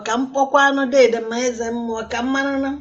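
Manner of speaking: ordinary talk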